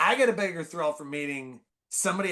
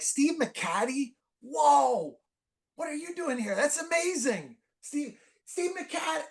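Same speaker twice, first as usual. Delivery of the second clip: very high fanboy cry, held back in volume; retold excited scream; 12 semitones higher, slower than usual. Fan screaming out to the pitching coach, mock excited scream. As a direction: mimic a starstruck scream without full force. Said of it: "I scream out".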